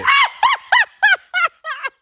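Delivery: a high burst of laughter; helpless laughter; high giggle. Real, not acted. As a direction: crack up again. Wife laughing again.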